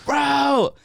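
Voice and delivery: loud, high-pitched "bro"; excited exclamation. Real, not acted. Cohost amazed by the merch video, excited high exclamation.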